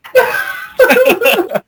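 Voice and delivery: high shrieking burst of laughter. Real, not acted. Friend laughing at the mock argument, shrieking laugh.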